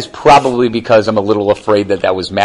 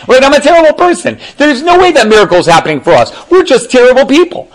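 high, louder self-condemning rant; Shlish lamenting; 12 semitones higher, 4 dB louder than usual. Acted. Officer telling Elisha, high anguished self-blame. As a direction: pile on the guilt, pitched high. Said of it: "said the Shlish to Elisha".

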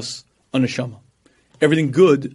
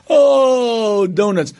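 higher, hammed-up sigh of craving for the "donuts"; mock longing moan; 6 semitones higher, 3 dB louder, much slower than usual. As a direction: groan like a dieter who can't resist. Women moaning over lost snacks.

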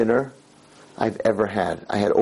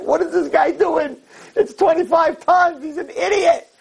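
very high, incredulous squawk; mocking disbelief; 3 dB louder than usual. Angels laughing at a man pushing a boulder, high incredulous mockery. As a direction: ridicule him in a high-pitched cry.

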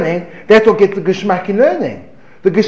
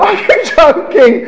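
drawn-out, higher, laughing disbelief; mock disbelief; 9 semitones higher, 4 dB louder, slower than usual. Teacher mocking a student's claim, laughing disbelief. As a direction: stretch it out in amused shock.

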